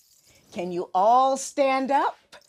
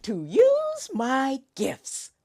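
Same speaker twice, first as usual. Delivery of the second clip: kindergarten teacher voice; 4 semitones higher, 5 dB louder than usual. Acted. Host leads pledge slowly.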